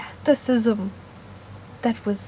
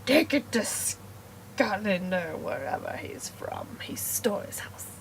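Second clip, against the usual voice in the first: whisper; 4 semitones lower, 9 dB quieter than usual. Acted. Teller whispering that the innovator stole the house. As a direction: drop to a conspiratorial hush.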